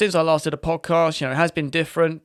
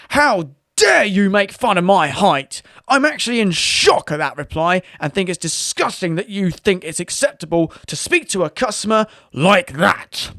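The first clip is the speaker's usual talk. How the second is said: outraged customer voice; 5 semitones higher, 4 dB louder than usual. Acted. Customer fires back at support, higher and indignant. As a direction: play the shocked customer in full huff. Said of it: "I don't know why I'm talking this way".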